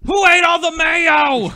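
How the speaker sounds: high, loud, slow mock yell; yelling across the room. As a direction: call out to the house like an annoyed dad. Suburban dad yelling.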